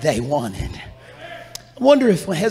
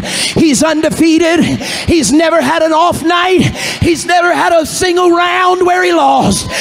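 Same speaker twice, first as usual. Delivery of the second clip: loud, high, rising preacher's cry; full-force preaching; 7 semitones higher, 8 dB louder than usual. Acted. Preacher rallying the congregation, loud and high. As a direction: preach it out with mounting fire.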